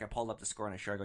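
ordinary talk, nothing marked

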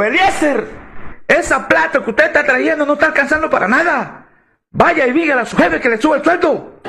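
high, demanding wife's voice; wife ordering her husband to ask for a raise; bossy wife. Retold or imitated. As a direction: nag him about the money.

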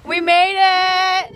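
slow, triumphant cheer; celebrate, drawn out; excited cheer. Real, not acted. Canoe launched successfully.

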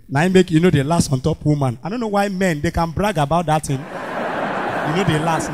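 ordinary talk

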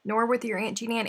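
normal talking voice

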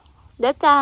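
"เด้อจ้า" sung out high and very slowly in a sweet Isan tone; a charming Isan "เด้อจ้า", drawn out slowly on a high pitch; sweet Isan lilt. Shown as a demonstration. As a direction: stretch "เด้อจ้า" into a high, slow, melodic Isan ending. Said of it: "เว้าอีสานม่วนๆแบบ".

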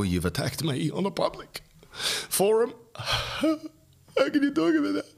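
climbing to a high, half-voiced squeal; high-pitched mock outrage; mock indignation. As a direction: act out a scandalised complaint, voice rising high. Acting out wife's indignant reaction.

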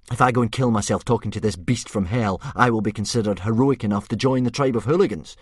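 put-on loud, slightly high boy's voice; indignant boy. Hiccup checks with father that exile is over, loud boy's voice. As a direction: bargain firmly with your father.

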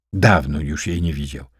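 ordinary delivery, nothing marked

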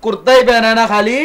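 loud, high, fed-up yelling; exasperated shout. Acted. Cousin shouting at the village boy over his kurta, loud and high. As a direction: bark it out in disbelief.

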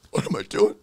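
high, stricken voice; acted shock at your own wrongdoing; stunned realization. Acting Philip's shocked realization.

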